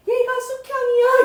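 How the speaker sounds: high, lightly voiced old granny. Grandmother's note to Lee Seon's mother, lightly voiced old granny.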